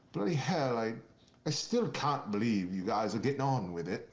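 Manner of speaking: mock posh British member of parliament; lightly done British accent, amused. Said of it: "he had that British accent".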